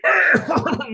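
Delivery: high burst of laughter; amused laugh. Real, not acted. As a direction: laugh at your own joke. Laughs at own bit.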